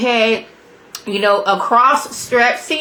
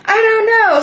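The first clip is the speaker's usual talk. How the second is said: panicked answer; 13 semitones higher, 5 dB louder than usual. Retold or imitated. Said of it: "She's like".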